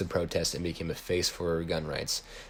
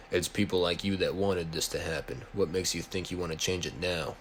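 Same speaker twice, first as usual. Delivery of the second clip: ordinary delivery, nothing marked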